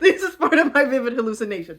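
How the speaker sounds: high laugh, then fast loud words. Real, not acted. Vlogger cracking up at her own invented alarm details. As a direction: laugh at yourself, then push on.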